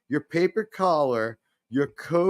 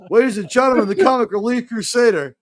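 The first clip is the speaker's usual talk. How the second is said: announcer voice; 7 semitones higher, 7 dB louder, faster than usual. Pretend. Introducing a guest like a host.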